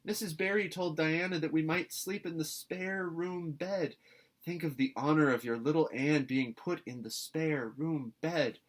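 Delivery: put-on high, bright girlish pleading; a breathless excited girl begging; an eager girl pleading with her guardian; eager young Anne. A narrator's reading.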